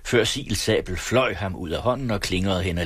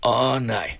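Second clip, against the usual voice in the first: mild plea, pitched higher; pleading; 5 semitones higher than usual. A narrator's reading. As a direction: beg lightly to be let off. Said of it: "bad han". (let bedende tonefald)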